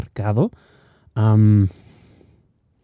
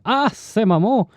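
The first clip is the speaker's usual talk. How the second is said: fake Mexican slang accent; 12 semitones higher than usual. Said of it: "no soy muy bueno".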